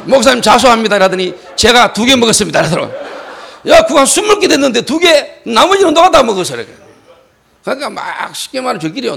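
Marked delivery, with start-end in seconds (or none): 0.1-1.0 higher, mock-solemn surrender; loud confession. Kneeling boy confesses to pastor, loud mock confession. 1.6-2.6 higher, louder admission; loud confession. Retold or imitated. Boy admits eating two chocolates, loud mock confession. 3.7-6.6 high, loud accusation; angry yell. Retold or imitated. Pastor accuses boy of eating the rest, high angry yell. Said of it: "내가 소리 지르고 막 그러다가".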